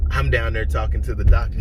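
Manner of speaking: normal talking voice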